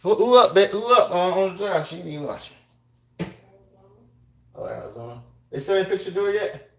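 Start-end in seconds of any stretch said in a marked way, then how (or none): 0.0-1.9 raised, higher call across the house; calling out to babe. Real, not acted. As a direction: shout the question to someone in another room.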